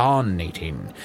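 "resonating" sounded out apart from "Resin Nate"; corrective pronunciation. Retold or imitated. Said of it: "This is about".

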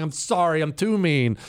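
normal talking voice

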